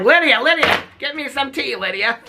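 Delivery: lazy mother calling the daughter to fetch her tea; mock lazy mom. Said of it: "And she's like".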